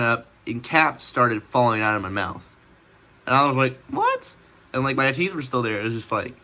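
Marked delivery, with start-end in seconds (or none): 3.9-4.2 shocked squeak. Retold or imitated. Shocked squeak as caps fall out.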